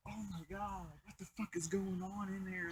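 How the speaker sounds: very soft, stunned aside; acted-out quiet astonishment; hushed disbelief. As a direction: drop to a near-murmur, as if overhearing. Overhearing screaming from Bobby's house.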